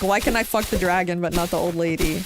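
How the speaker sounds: ordinary talk, nothing marked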